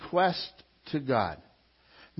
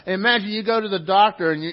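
ordinary delivery

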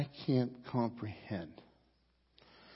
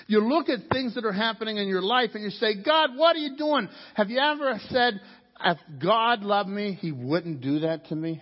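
loud, high, fervent sermon climb; preaching at full force; 10 semitones higher, 8 dB louder than usual. Acted. Pastor preaching hard to his church, fervent.

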